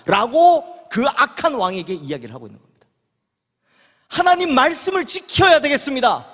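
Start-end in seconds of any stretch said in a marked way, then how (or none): 4.1-6.3 higher-pitched, resolute proclamation; firm declaration. Acted. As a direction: stand your ground before the king. Nabot trotseert de koning.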